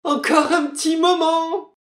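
tiny, very high voice; pleading wife. Acted. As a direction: beg meekly for one moment. Wife begs for delay.

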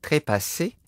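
puzzled rising cry; surprised insect. A narrator's reading. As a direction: exclaim it in wonder. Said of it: "s'écria l'éphémère".

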